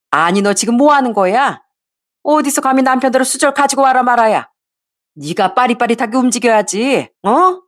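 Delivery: a hint of a mother-in-law telling off her daughter-in-law; scolding mother-in-law. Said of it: "아주 큰 소리로 절 혼내키곤".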